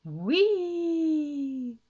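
long high squeal of delight; swooping, drawn-out cry of joy; gleeful whee. A narrator's reading. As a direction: whoop as if flung into the air. Bouncing up into the air.

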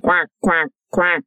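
lightly voiced duck quack. Duck quacking as she swims away.

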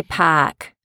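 Boston "Park" without the r; Boston accent. Shown as a demonstration. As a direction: drop the r in "Park", Boston style. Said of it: "Can you say".

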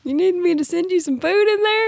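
high, singsong offer through the door; playful high-pitched checking in; mock concerned call. Acts out calling to husband.

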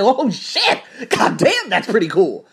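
loud, high surprised whoop; excited amazement. Retold or imitated. Amazed shout at the buff guy speaking English.

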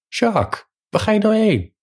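high-pitched call after someone leaving. A narrator's reading. Peter calls after fleeing Jacques, high-pitched calling out.

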